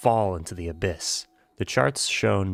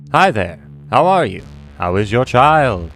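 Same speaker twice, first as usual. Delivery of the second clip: hammed-up greeting; 3 semitones higher, 6 dB louder than usual. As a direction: call out an exaggerated hello in a put-on voice. Puts on a greeting voice.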